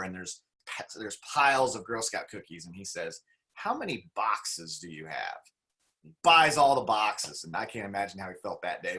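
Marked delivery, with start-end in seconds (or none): 3.5-5.4 calm low confident voice. Retold or imitated. Retold successful man asks.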